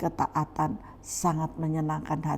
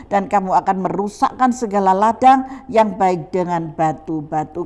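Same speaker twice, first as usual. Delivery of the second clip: loud, raised declamatory Bible reading; forceful scripture reading; 5 semitones higher, 10 dB louder than usual. Acted. Preacher proclaims God's word to the congregation, forceful reading.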